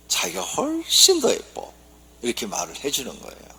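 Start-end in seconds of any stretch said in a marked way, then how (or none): 0.1-1.7 much higher, slow, sugary coo; sweet Seoul husband. Acted. Seoul husband answering his wife, slow high coo. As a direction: coo the compliment slowly, pitched up. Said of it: "서울 남편은".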